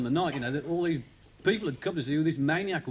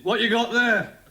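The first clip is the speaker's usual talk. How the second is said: barked sergeant's question, louder; a desk sergeant barking at the new arrival; gruff sergeant; 6 semitones higher, 5 dB louder than usual. Retold or imitated. Sergeant barks at the arrested comic, louder.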